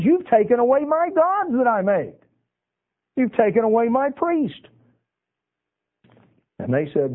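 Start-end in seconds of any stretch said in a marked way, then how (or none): 0.0-4.7 high-pitched, acted-out protest; upset. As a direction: cry out as the wronged owner, retold. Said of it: "he was all upset".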